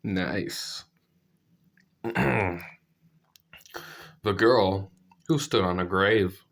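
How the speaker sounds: ordinary delivery, nothing marked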